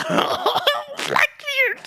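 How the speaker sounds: very high, laughing cry; excited squeal. Real, not acted. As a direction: squeal the name in hysterical excitement. Excited at villain's arrival.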